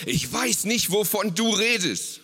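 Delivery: mildly acted agitated denial; agitated outburst. A narrator's reading. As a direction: snap it out, cornered and upset. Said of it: "stieß aufgebracht hervor".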